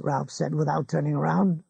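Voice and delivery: ordinary delivery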